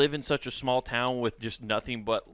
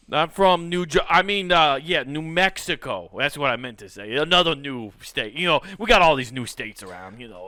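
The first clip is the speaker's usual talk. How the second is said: a light fake Italian accent. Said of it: "fake Italian accent".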